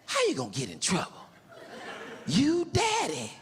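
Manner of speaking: a lightly voiced cheeky four-year-old; kid voice. Child mocking his father.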